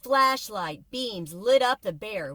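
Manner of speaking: ordinary delivery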